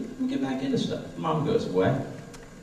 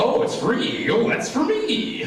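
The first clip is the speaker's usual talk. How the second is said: mock naive passenger; slower than usual. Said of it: "you're like".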